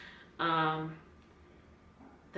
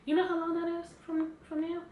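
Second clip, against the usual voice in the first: ordinary delivery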